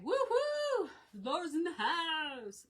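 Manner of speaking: high, loud whoop of welcome; hearty high-pitched hollering welcome; excited cheer. Real, not acted. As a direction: whoop it out, then stretch the shout-out. Cheering a viewer's arrival.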